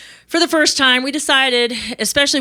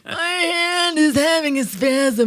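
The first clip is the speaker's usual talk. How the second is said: high, slow, whining about a cramping hand; high whiny author voice; whiny mock complaint; 8 semitones higher, slower than usual. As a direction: moan it like a worn-out author. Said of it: "I have hand cramps".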